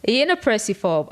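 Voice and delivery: ordinary delivery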